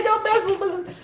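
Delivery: high, laughing mock warning; mimicking friend. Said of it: "She say".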